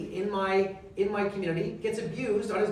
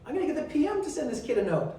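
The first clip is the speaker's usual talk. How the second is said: breathy start, then jumping high with excitement; the lightbulb moment, pitched way up; eager high-pitched scheming; sudden bright idea; 9 semitones higher than usual. Retold or imitated. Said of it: "I said".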